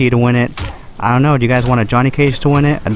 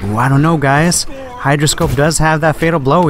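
ordinary talk

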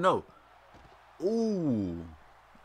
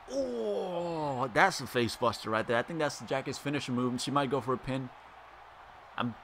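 usual speaking voice

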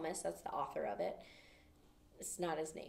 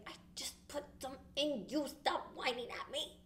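grumpy scolding; 5 semitones higher than usual. Retold or imitated. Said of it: "Makes me a little grumpy".

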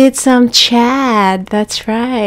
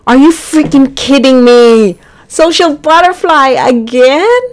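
higher and louder, playful disbelief; mock outrage; 4 semitones higher, 5 dB louder than usual. Real, not acted. Player complaining the trait repeated, mock outrage. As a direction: act betrayed by the game. Same trait rolled twice.

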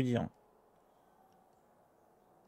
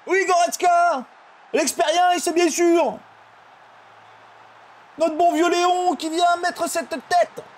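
high, loud goal announcer; commentator voice; 4 dB louder, slower than usual. Pretend. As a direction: call the goal like TV. Mock commentator continues.

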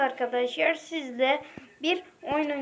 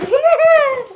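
much higher and louder, squealed; shrieking shock; 12 semitones higher, 12 dB louder than usual. Real, not acted. Kid squealing in shock at a Roblox outfit. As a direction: squeal it in outrage.

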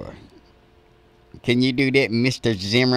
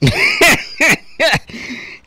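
big laugh. Real, not acted. Host laughs at the punchline.

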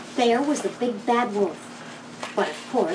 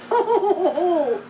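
high hammed-up ho ho ho; mocking laugh; 8 semitones higher than usual. A narrator's reading. Second pig mocks his busy brother, high laughing ho ho. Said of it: "laughed the second little pig".

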